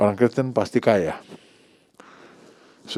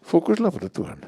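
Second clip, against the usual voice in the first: "Fokuslah pada Tuhan" said much higher, leaning on "Fokuslah"; emphatic repeat; 8 semitones higher than usual. Shown as a demonstration. Preacher repeats his call to the congregation, pitched high. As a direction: lift "Fokuslah" high and let "Tuhan" fall.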